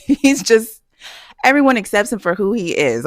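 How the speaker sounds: high, loud laughing talk. Real, not acted. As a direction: crack up while describing a hilarious friend. Laughs about hilarious coworker.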